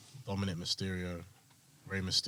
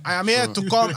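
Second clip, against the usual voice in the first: high, loud mock African accent imitation; hammed-up mock accent; 9 semitones higher, 4 dB louder than usual. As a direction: put on the Coming to America accent. Mocking the accent.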